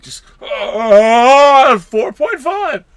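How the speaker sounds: high, slow, loud quaking call; mock quake voice. Showing how Kurt Quake sounds, slow quaking call. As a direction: quake the words out. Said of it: "It's a quake. You're supposed to quake".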